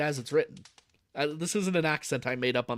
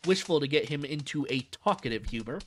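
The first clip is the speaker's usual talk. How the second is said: ordinary delivery, nothing marked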